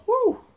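high, loud "whew" of relief; retold whew. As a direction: imitate a big high-pitched whew. Retold relief that figure was gone.

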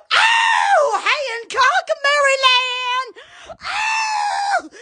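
high excited cries of a place name; can't hold back the excitement; excited shout. Real, not acted.

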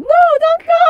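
high, loud desperate cry; pleading shout. Acted. Begging his friend to stay, loud plea. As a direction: call him back before he leaves.

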